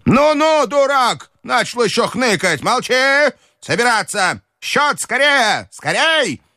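put-on high, scolding old lady's rant; furious old grandmother. A narrator's reading. Grandmother berating the Frenchman, high furious rant. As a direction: tear into the Frenchman.

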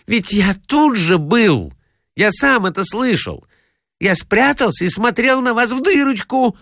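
insistent nephew. A narrator's reading. Nephew telling his uncle he was there hiding, urgent and insistent. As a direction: insist you saw and heard it yourself.

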